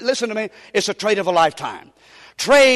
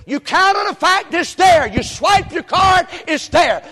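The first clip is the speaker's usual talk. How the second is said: loud, high insistence; preaching at full force; 8 semitones higher, 4 dB louder, slower than usual. Acted. Preacher pressing the credit card example on the church, loudly. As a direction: press the point with fire.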